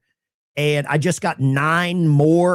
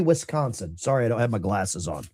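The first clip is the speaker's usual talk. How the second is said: usual speaking voice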